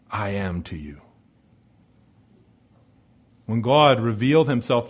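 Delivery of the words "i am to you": dropped low, subdued voice; grave hush. Acted. God revoking the covenant with his people, grave hush. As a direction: let pitch fall away solemnly. Repeat of God's solemn rejection.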